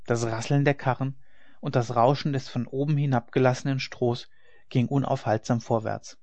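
ordinary delivery

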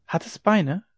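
lightly voiced child, high question; child's voice. A narrator's reading. Child asks mother about the water, lightly voiced child. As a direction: ask as a puzzled small child.

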